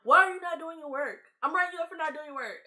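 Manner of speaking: scolding teacher. Retold or imitated. Teacher writing up a student, loud scolding. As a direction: tell off the kid like a fed-up teacher.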